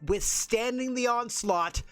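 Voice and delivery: slow, lifted wrap-up; announcer recap. Acted. Commentator recapping the night's title defenses, slow broadcast voice.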